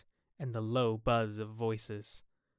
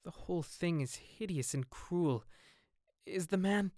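soft, higher-pitched anguish; hushed, upset and faltering; distressed; 6 semitones higher, 4 dB quieter than usual. Acted. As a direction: break off before the dreaded word. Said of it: "He could not finish the sentence".

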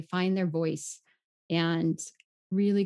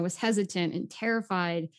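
ordinary delivery

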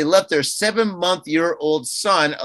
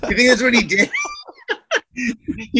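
talking through real laughter, higher pitch; laughing; 7 semitones higher than usual. Real, not acted. Co-host cracks up at host's accent, laughing hard. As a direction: crack up while getting the words out.

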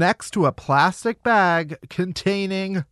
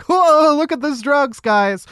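mock surprise; 7 semitones higher than usual. We're like.

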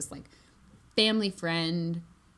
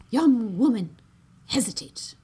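stern command; 5 semitones higher, 8 dB louder than usual. Acted. Stern lady commands Elsie.

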